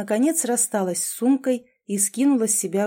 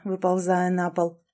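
ordinary delivery, nothing marked

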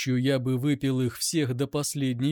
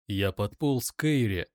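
ordinary delivery, nothing marked

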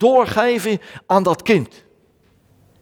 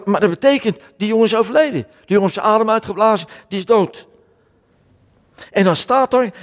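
ordinary talk

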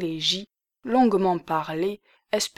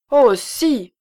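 sharp raised cry, higher and louder; exclaiming; 5 semitones higher, 6 dB louder than usual. A narrator's reading. Lisbeth cries out to Valérie, raised and emphatic. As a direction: burst out with it. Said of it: "s'écria la Lorraine".

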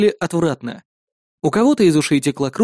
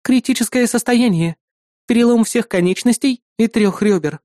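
higher female character voice, a touch of sadness; sad reply; 7 semitones higher than usual. A narrator's reading. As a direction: deliver bad news with understated sorrow. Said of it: "с грустью ответила она".

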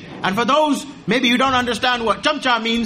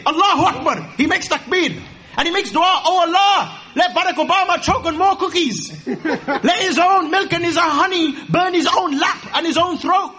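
fervent prayer; 8 semitones higher than usual. Acted. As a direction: cry out to Allah with mock zeal.

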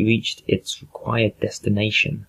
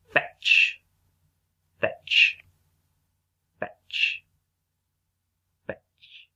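fading to a whisper; 3 dB quieter, much slower than usual. Acted. Robot repeats word, fading out.

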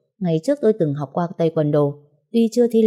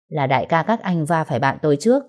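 plain reading voice

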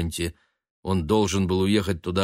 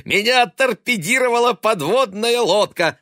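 high, loud clowning cry; silly hilarity; 14 semitones higher, 6 dB louder than usual. A narrator's reading. Son poking his worried mother, clowning loudly. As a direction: crow the joke at top pitch. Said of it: "Он идиотски расхохотался".

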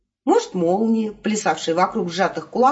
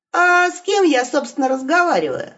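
higher, bold wolf; cheeky wolf voice; 8 semitones higher than usual. Acted. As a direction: ask boldly in the wolf's voice. Wolf asks voice. (дерзкий голос волка)

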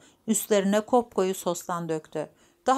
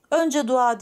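higher, louder demand from grandmother; insistent; 5 semitones higher, 6 dB louder than usual. Acted. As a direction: demand it stubbornly, cutting in. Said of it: "diye tutturdu büyük anne". (ısrarla tutturarak)

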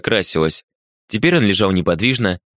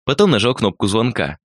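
plain reading voice

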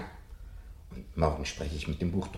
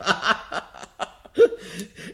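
a high, loud burst of laughter; delighted laugh; 12 semitones higher, 9 dB louder than usual. Acted. Professor laughing with delight that the gadget works.

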